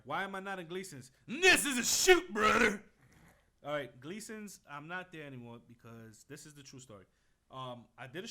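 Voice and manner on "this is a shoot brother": loud, slow, high declaration; shouting. Real, not acted. Answering why he left the gym, a loud slow shout. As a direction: bellow it out to the room. Heated reply about leaving gym.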